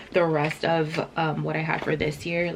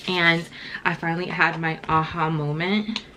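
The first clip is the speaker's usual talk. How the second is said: ordinary delivery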